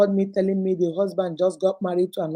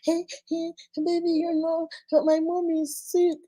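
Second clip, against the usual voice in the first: put-on high, tiny baby voice; mock baby whine, high and small; babyish side-chick voice; 7 semitones higher than usual. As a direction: beg like a two-month-old baby. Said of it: "their voice, they can't talk".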